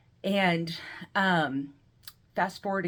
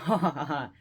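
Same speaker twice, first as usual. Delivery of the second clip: spelled-out scoffing laugh; mock laugh; 3 semitones lower than usual. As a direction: put on a cocky, fake laugh. Retold scoff at brother's doubt.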